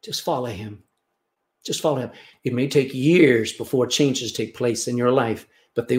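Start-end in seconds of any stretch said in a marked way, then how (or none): 0.0-0.8 hushed. Shown as a demonstration. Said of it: "You know what Jesus expects?".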